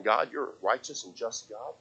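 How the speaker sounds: very soft, low prayer; quietly acted, settled trust in God; calm assent to God. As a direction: speak low and hushed, accepting. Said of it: "He's saying".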